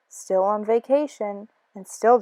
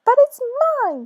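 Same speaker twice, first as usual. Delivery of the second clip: scream; 14 semitones higher than usual. Acted. Maisie claiming the egg, high scream. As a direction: screech it in protest. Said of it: "screamed the bird".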